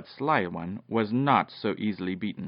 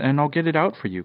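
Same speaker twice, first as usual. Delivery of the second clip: quick, slightly raised call; 5 semitones higher, 5 dB louder, faster than usual. A narrator's reading. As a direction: call out a brisk offer of help. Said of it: "called Popoff".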